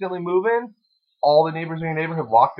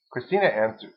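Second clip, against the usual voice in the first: ordinary delivery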